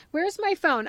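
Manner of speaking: usual speaking voice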